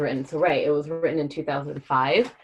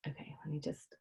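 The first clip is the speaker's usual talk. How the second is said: whisper; 16 dB quieter than usual. Real, not acted. Aside while setting up.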